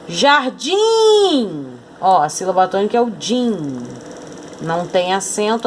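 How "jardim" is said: "Jardim" called out high, "-dim" held long; calling the word. Shown as a demonstration. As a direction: call "Jardim", stretching and raising the last syllable. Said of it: "a gente chama". (chamando "Jardim", com "-dim" agudo e alongado)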